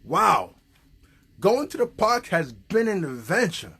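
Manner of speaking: usual speaking voice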